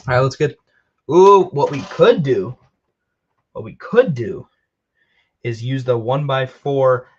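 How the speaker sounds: normal talking voice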